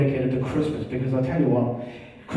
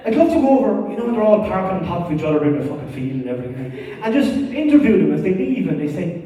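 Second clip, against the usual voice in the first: ordinary talk, nothing marked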